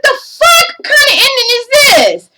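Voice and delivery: sudden high-pitched disbelief; shocked, high exclaim at a bad ending; incredulous outburst. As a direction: react like you can't believe what you just watched. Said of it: "And I was like".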